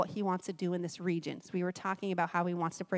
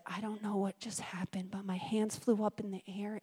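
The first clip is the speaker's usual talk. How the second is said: hushed, breathy retelling of her words; whispered quote; 7 dB quieter than usual. Retold or imitated. Retelling the lady's late-night message, whispered in awe.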